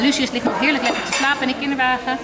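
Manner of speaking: ordinary delivery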